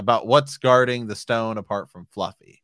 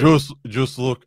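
slow, higher, put-on Hagrid grunt; 5 semitones higher, 8 dB louder, slower than usual. Cohost retrying the line as an exaggerated Hagrid. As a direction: drag it out as a hammed-up Hagrid. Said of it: "I can't do the Hagrid voice".

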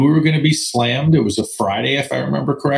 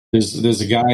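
ordinary talk, nothing marked

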